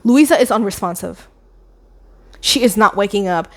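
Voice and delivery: ordinary talk, nothing marked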